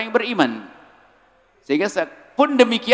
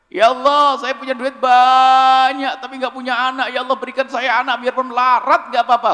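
higher, louder mock plea of a rich man; pleading rich man's prayer; 6 semitones higher, 5 dB louder than usual. Preacher mimics a rich man begging God for a child, hammed-up plea. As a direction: beg like a man who has money but no child. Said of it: "gak boleh ngomong gitu".

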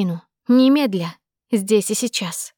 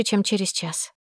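ordinary delivery, nothing marked

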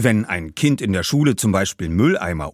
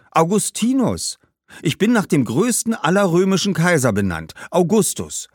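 put-on higher, eager boy's voice; insistent young boy; 6 semitones higher, 3 dB louder than usual. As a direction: explain proudly in a raised pitch. Boy explains himself. (stolzer Junge, höhere Stimme)

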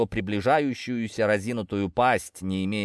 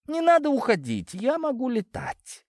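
much higher, floaty and tipsy; high giddy voice. A narrator's reading. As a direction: sing it out drunk on bliss. Intoxicated cat protests.

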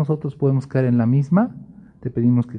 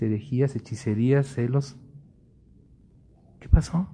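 normal talking voice

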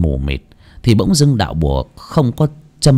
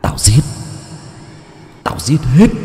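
hissing ghost; 4 semitones higher than usual. Acted. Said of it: "Những cái bóng rít lên".

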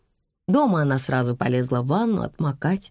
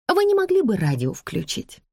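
higher-pitched courteous woman; lightly pleading, polite asking; polite request; 6 semitones higher than usual. A narrator's reading. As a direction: ask politely for a favour. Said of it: "вежливо попросила женщина".

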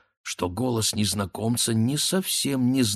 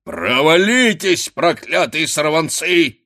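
slow, loud, high roar; furious drawn-out yelling; angry shout; 9 semitones higher, 7 dB louder, slower than usual. A narrator's reading. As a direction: roar at the young rascals. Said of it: "кричал голова".

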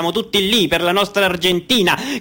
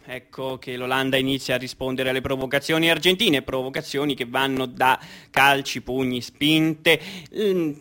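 softer, grave match report; subdued commentary; 4 dB quieter than usual. Acted. Commentator describing a violent match, hushed.

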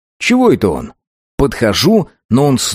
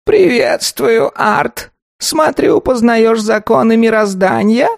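high, slow, creaking golem monotone; a wooden golem creaking out a greeting; creaky golem voice; 13 semitones higher, slower than usual. A narrator's reading. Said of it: "скрипит голем".

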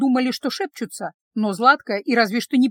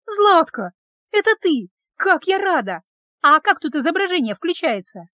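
high, loud, delighted voice; overjoyed greeting; 6 semitones higher, 4 dB louder than usual. A narrator's reading. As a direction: greet a long-lost friend with joy. Подруги радостно узнают друг друга. (радостно, высоко и громко)

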